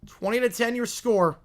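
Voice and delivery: lively TV announcer calling the drive; play-by-play call. Acted. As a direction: call the game like a network broadcaster.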